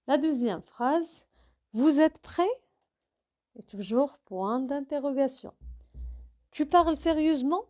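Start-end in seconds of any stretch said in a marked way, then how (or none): 1.7-2.5 louder demo question rising on "prêt"; rising question intonation. Shown as a demonstration. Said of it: "ça, c'est l'intonation". 6.5-7.6 quick question rising on "sérieusement"; teacher's demo of question tune on "sérieusement"; rising question intonation. Shown as a demonstration. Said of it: "ça, c'est l'intonation".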